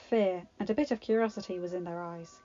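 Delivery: ordinary delivery, nothing marked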